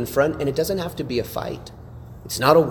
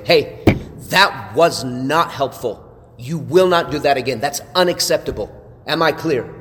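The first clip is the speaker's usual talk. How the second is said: louder, firm, no-nonsense warning; a leader drawing a hard line, stern and louder; stern rebuke; 4 dB louder than usual. Shown as a demonstration. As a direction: lay down the law, firmer but not yelling. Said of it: "That's tone".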